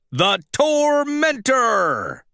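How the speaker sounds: slow, drawn-out ringside announcement; announcer declaring winner. Acted. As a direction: stretch the name out to pump up the crowd.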